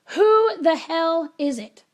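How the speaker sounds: retold fed-up shout; exasperated yell. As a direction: yell it slowly through a shut door. Fed up, shouting through door.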